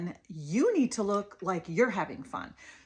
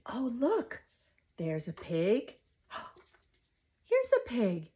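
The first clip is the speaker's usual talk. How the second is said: put-on wondering sing-song talk to a toddler; playful, lilting invitation. Shown as a demonstration. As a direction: gasp and lilt to catch a child's attention. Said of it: "and I'm sing-songing".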